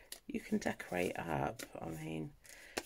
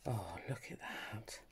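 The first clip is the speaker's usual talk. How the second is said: hushed admiring whisper; 5 semitones lower, 8 dB quieter than usual. Real, not acted. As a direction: whisper it softly in delight.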